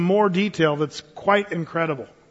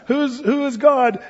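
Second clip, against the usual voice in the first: put-on slow, high question to little kids; lightly voiced teacher quizzing a class; Sunday school teacher; 7 semitones higher, slower than usual. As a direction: pose it as a children's teacher. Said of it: "when you're in Sunday school".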